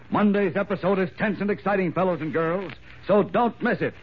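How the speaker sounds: high, excited teaser voice; old radio announcer. Acted. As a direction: build hype for the next episode.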